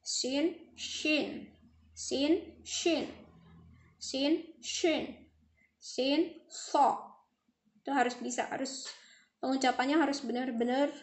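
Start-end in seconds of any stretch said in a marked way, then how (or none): 0.0-7.0 sin against shin; sin and shin contrast. Shown as a demonstration. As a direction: hiss "Sin" then hush "shin". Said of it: "Sa dengan sya, beda lagi".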